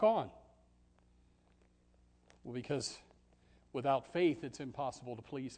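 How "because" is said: normal talking voice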